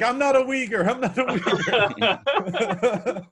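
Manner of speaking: high-pitched mock panic; mock scream. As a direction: imitate her protesting in a high, drawn-out cry. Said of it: "She screams as they come on".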